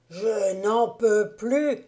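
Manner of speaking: loud, drawn-out hungover wail; crying out. A narrator's reading. Hungover roadman cries out that he can't go on, loud and slow. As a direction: cry it out slowly, at the end of his rope. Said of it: "s'écria-t-il de nouveau".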